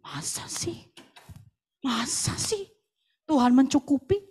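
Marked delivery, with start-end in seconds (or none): 0.1-2.7 whispered doubt; softly whispered masa sih. Acted. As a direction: hush it like a sceptic's murmur. Doubting aside to congregation.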